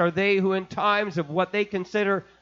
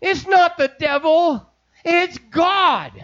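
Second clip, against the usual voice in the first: much higher, loud preacher's cry; shouted preaching; 10 semitones higher, 6 dB louder than usual. Acted. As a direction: hit the peak of the sermon loud and high. Peak of sermon.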